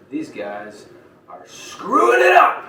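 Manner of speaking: slow build to a loud, high yell; pissed outburst. Acted. Villain ranting to his crowd about the Dungeon Dogs, pissed. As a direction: blow up on the last words, stretched out. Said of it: "starts going nuts and he's pissed".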